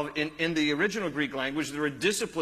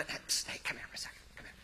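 hushed secret whisper; 13 dB quieter, faster than usual. Retold or imitated. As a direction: whisper to call the kid over. Sneaking up on the child.

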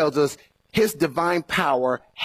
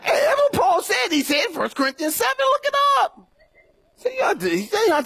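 much higher and laughing through it. Real, not acted. Preacher laughing about Paul's advice to stay single, high laughing. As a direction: laugh while you insist.

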